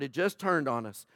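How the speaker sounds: usual speaking voice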